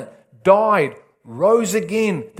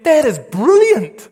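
louder, higher, slow marvelling; impressed inner voice; 6 semitones higher, 5 dB louder than usual. Retold or imitated. His intellect marvelling at a revelation, a loud impressed exclamation. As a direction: savour each word, amazed. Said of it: "my intellect heard and went".

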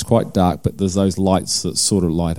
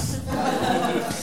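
light laugh; 11 dB quieter than usual. Real, not acted. Laughs at own story.

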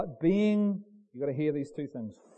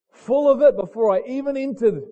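full-force preaching; 7 semitones higher, 10 dB louder than usual. Real, not acted. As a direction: drive each phrase home to the congregation.

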